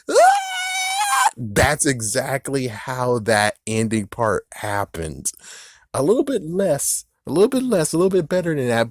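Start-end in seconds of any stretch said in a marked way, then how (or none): 0.1-1.3 shrieking operatic high note; a comically shrill sung "Ah!"; mock Fiona high note. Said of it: "Princess Fiona singing to the bird". 5.9-8.9 mock high. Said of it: "high comic comparison".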